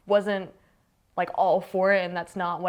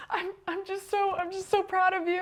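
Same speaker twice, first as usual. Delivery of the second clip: pitched-up retelling of mom choking up; imitating tearful mom; 12 semitones higher than usual. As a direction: imitate an emotional mother in a small high voice. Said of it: "she was crying".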